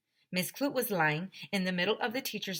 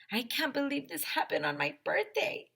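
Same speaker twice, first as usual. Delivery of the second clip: put-on upset girl; 7 semitones higher than usual. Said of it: "said Emily".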